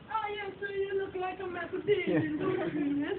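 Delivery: very high, hammed-up Italian accent; mock Italian woman. Italian tourist spotting a Matt Damon lookalike, high mock accent. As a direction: squeal it as a caricatured Italian woman. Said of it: "the Italian woman's like".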